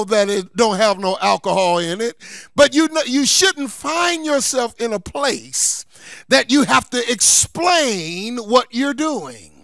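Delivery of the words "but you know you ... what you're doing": ordinary delivery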